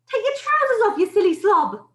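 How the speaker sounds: high, loud exasperated order; shouted. A narrator's reading. Cook ordering the panicking man to undress, shouted. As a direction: shout it high at a hopeless workmate. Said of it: "someone else shouted".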